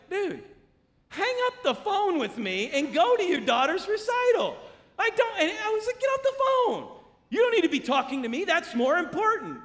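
high, loud, incredulous scolding; hammed-up exasperation; exasperated order. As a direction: urge a friend away in disbelief. Retold exasperated order to the man.

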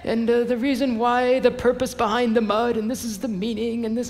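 mock British commentator, pitched high; British accent. Preacher mimicking tea-drinking commentary writers, mock British accent. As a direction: put on a high British scholar's voice. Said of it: "they've got a British accent".

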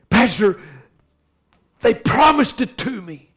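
high, plaintive mock protest; hammed-up hurt pleading; imitating upset woman. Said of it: "she's emotionally upset, really troubled".

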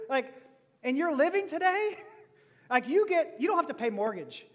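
ordinary talk

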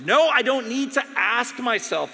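loud, high sharp refusal; angry rebuff. Retold or imitated. Dying doctor rebuffing the chaplain's advice. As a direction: throw it back at her.

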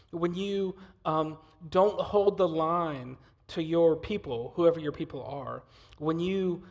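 loud, rising sermon delivery; fiery preaching. Acted. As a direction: preach it with full force. Preaching builds to full force.

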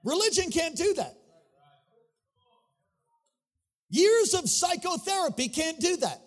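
high, driving sermon delivery; full-force preaching. Real, not acted. Preacher urging the house to welcome the Holy Spirit, full-force preaching. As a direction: preach it with rising fervor, stretching key words.

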